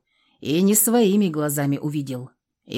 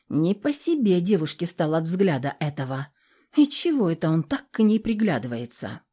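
usual speaking voice

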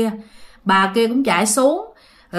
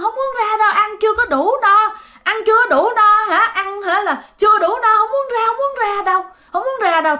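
high, whiny tearful pleading; crying refusal; 10 semitones higher than usual. Acted. Possessing ghost crying to the landlady that it won't leave, high whiny sobbing. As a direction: whimper that you will not leave. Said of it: "Khóc lên, khóc lên nữa các bạn".

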